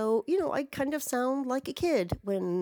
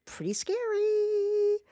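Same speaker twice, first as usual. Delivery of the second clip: spooky voice; 11 semitones higher, slower than usual. Pretend. Mock spooky aside.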